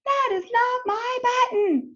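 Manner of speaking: high, sing-song shout. Acted. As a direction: Toad yells it out, half-sung. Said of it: "shouted Toad".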